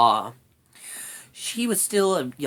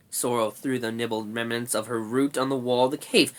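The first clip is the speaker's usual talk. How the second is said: ordinary delivery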